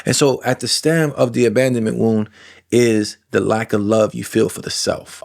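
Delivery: usual speaking voice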